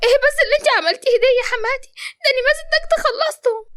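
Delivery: daughter-in-law shocked as her washed carpets are ruined. Acted. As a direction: blurt it out in disbelief. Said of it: "ريحانة بصدمة".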